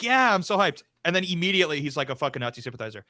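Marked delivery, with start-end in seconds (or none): 0.0-0.8 mock hyped. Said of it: "And I'm like".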